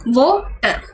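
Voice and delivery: वो, a gap, then टर; split syllables. Shown as a demonstration. Teacher showing what syllables are, split apart. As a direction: break the word into two separate syllables. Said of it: "आपने वो बोला फिर टर बोला".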